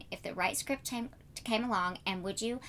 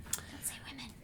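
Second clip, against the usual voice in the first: unvoiced whisper, much softer; teasing whisper; 11 dB quieter than usual. Real, not acted. Host whispers a teasing aside to her guest.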